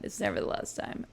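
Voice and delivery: hushed knowing whisper; breathy aside to the viewers. Real, not acted. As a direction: lean in and whisper it like a secret. Repeats the whispered warning.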